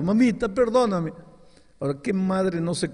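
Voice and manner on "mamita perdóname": high, louder tearful begging; crying plea. Retold or imitated. Kneeling boy begs his mother, crying. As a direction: kneel and beg through tears.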